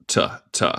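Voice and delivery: T as a bare aspirated consonant; crisp T sound. Shown as a demonstration. Teacher showing learners the T sound, crisply released. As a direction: sound out T alone, a puff without voice. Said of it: "suena como T siempre".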